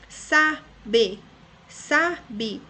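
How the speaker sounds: "Sabe" as SA... BE, two separate beats; syllable by syllable. Shown as a demonstration. Teacher shows two syllables, beat by beat. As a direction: break "Sabe" in two with a full E. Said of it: "uma palavra que tem duas sílabas".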